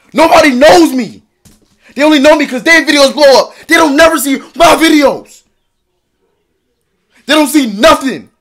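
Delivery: raw, desperate shouting rant; anguished yelling. Real, not acted. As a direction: scream out the frustration. Distraught rant about failing channels.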